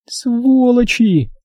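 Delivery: long, high drawn-out curse; anguished curse. Acted. Dinka curses the gang before collapsing, anguished. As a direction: stretch it into a high despairing cry.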